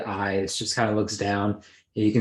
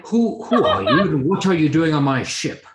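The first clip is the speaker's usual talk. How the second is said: startled captain, higher and louder with a laugh; gruff captain; 6 semitones higher, 4 dB louder than usual. Acted. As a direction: demand it like an outraged ship's captain. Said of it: "He says".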